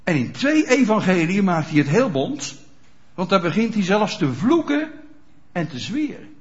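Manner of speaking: ordinary delivery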